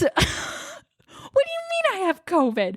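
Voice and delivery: very high, slow, squeaked disbelief; hammed-up incredulous question. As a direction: retell it as a drawn-out high squeal of shock. Retelling shock at covid diagnosis.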